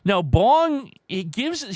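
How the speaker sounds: ordinary talk, nothing marked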